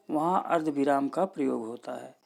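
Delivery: ordinary talk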